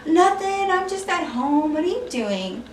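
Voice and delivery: high coy lilt, softening at the end; mock-happy chat; happy phone voice. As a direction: imitate a girl delighted he called. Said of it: "she sounded so happy".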